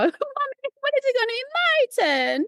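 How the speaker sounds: very high mock child wail; imitated crying girl. Little girl asking mommy for her turn, mock crying. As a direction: squeal it like a whining kid, hammed up. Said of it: "Why is she crying".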